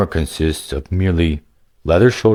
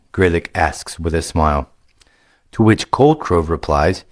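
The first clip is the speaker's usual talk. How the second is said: usual speaking voice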